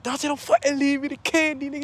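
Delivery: high, urgent retold plea; panicked plea. Teller to fleeing cousin over dropped candy, high panicked plea. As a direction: act out begging a cousin mid-chase.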